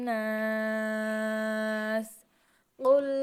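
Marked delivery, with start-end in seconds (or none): 0.1-2.1 নাস with the ন held long and nasal; nasal ghunnah. Shown as a demonstration. Teacher shows pupils the ghunnah on নাস, long and nasal. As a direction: hum the ন of নাস through the nose, drawn out slowly. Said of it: "এখানে টেনে পড়তে হবে".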